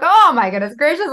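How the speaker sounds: loud, high-pitched exclamation of joy; loud happy gasp of disbelief; overjoyed exclaim. Retold or imitated. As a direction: burst out delighted. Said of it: "I'm like".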